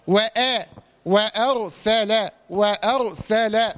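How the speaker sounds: slow وأرسل, light opening and thick ر; careful slow وأرسل with a full still ر; only the raa heavy. Shown as a demonstration. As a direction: keep وأ thin, darken only the ر in وأرسل. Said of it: "الراء بس هو اللي مفخم".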